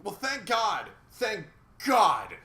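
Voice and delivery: loud mock-grateful thank God; a loud sarcastic thanks to heaven; sarcastic shout. Real, not acted. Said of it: "sarcastic relief, shouted".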